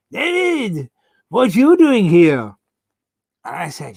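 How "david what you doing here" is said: put-on higher, louder Spanish-accented greeting, slowed. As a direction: imitate a Spanish friend's surprised hello in a Spanish accent. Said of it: "said in a Spanish accent".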